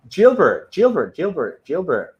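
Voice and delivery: high, sing-song calling of a name; excited welcome, fading off; excited greeting. Real, not acted. Greeting a new live viewer.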